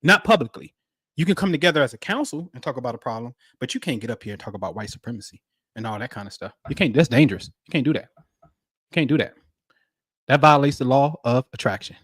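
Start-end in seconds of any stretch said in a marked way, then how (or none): 1.1-6.5 hushed warning. Real, not acted. Mock warning, dropped voice.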